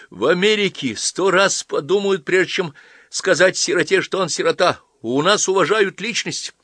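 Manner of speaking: high, loud heated protest; indignant. A narrator's reading. Young man defends his country to the old priest, indignant. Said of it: "злился Половинкин".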